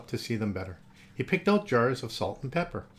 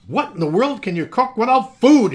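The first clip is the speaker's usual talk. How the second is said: astonished; 10 semitones higher, 13 dB louder than usual. Acted. Astonished uncle.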